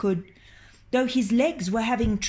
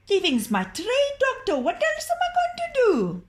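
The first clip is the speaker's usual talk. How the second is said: high, crying plea; whining plea; 4 dB louder than usual. Acted. Ali pleads with the doctor about thieving, high and crying. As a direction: wail it helplessly.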